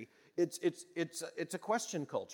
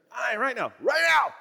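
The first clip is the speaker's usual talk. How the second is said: shouted louder and higher on the second right now; loud reply; 9 semitones higher, 11 dB louder than usual. Retold or imitated. Boy yelling back to his booming father, loud and rising. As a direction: yell back in a hurry, building up.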